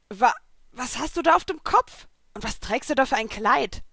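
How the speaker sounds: startled and bewildered; flabbergasted. A narrator's reading. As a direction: stumble into a baffled question. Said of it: "fragte er verdattert".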